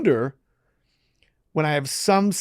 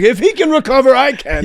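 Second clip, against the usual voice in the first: excited inmates; 12 semitones higher, 12 dB louder than usual. Retold or imitated. Inmates inspired, retold.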